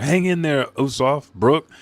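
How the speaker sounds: acted worried urging; urgent encouragement. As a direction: call out to injured friends. Voicing a friend urging on the injured.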